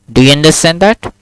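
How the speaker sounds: ordinary talk, nothing marked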